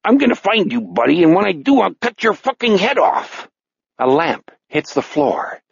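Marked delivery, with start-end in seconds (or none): 0.0-3.5 high, threatening Chicago tough; Chicago-accented killer on the hunt. Acted. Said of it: "In a Chicago voice, he said".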